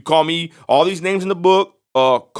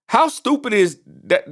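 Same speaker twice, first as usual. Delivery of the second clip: higher, slow disbelief; incredulous repeat; 7 semitones higher, slower than usual. Real, not acted. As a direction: drag it out, pitched up in disbelief. Repeats the scoff louder.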